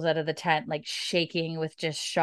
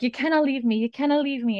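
higher, desperate pleading; grieving plea; 7 semitones higher, faster than usual. Retold or imitated. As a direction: refuse to let him go. Jamie grieving repeat.

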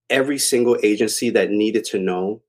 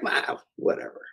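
muttered brush-off; 10 dB quieter than usual. Retold or imitated. Dismissive reply to girlfriend.

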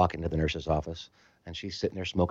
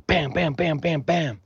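bam bam sounds, higher; imitated banging; 7 semitones higher, slower than usual. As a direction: knock it out with your voice.

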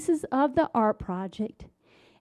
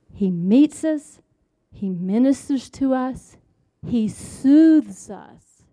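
emphatic preaching; 6 dB louder than usual. Real, not acted. Speaker telling listeners how God restores, emphatic preaching. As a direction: drive each point home with weighty stresses.

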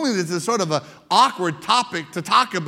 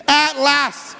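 exclaimed; 5 semitones higher, 3 dB louder than usual. Acted. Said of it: "the man exclaimed".

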